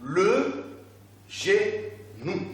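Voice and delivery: "Le genou" in slow separate beats; stretched "Le", then ge, pause, nou; syllable by syllable. Shown as a demonstration. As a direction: lead a class repetition, one syllable at a time. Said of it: "On peut répéter ensemble".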